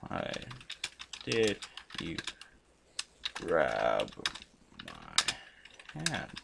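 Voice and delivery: word by word, with long gaps; halting dictation to yourself while typing; slow typing pace. Real, not acted. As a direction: sound out each word as you type it. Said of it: "Sorry, I'm a slow typer too".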